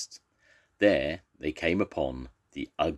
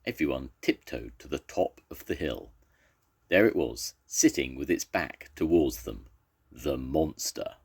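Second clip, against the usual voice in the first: ordinary delivery, nothing marked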